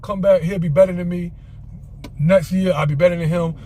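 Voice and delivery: ordinary talk, nothing marked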